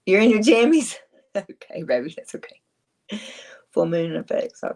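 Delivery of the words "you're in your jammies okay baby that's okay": sweet aside to her little one. Real, not acted. Talking to her child at home.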